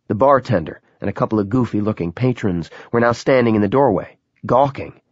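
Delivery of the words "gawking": usual speaking voice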